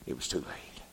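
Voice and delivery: preacher whispering the warning to the church; whispered. Acted. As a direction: drop to a breath for the doom. Sombre drop after loud line.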